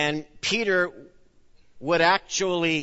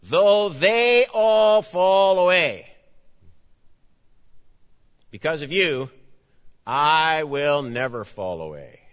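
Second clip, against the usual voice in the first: boastful Peter; 5 dB louder than usual. Retold or imitated. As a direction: proclaim it like a proud disciple boasting. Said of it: "Peter answers".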